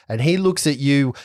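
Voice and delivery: normal talking voice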